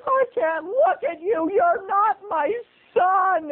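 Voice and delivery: high wailing sob, mother in despair; screaming and crying. Retold or imitated. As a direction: wail it through tears, pitch way up. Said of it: "fell to her knees and started screaming".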